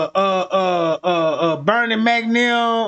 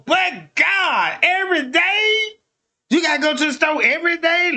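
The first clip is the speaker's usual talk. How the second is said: higher, whiny complaint; nagging girlfriend; 7 semitones higher than usual. Retold or imitated. Girlfriend complaining about store runs.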